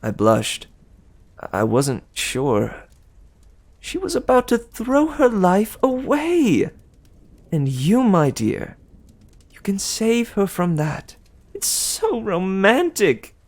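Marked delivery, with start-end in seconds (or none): 3.8-13.3 higher, sweet gossiping goddess; Aphrodite. Acted. Aphrodite teasing Percy about Annabeth, higher and sweet. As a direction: coo it like a doting goddess.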